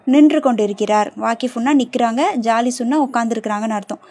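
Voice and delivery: ordinary talk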